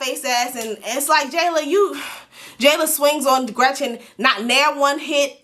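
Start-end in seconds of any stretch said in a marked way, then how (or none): none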